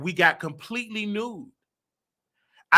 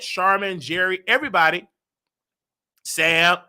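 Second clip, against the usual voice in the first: ordinary delivery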